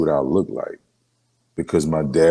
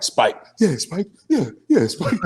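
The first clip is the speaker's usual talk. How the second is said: high, laughing cheer of a proud little brother; giddy high-pitched hype; gleeful cheer; 12 semitones higher than usual. Retold or imitated. Triumphant kid cheering behind brother.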